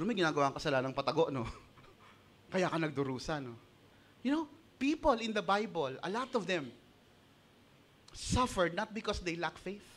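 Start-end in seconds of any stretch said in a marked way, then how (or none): none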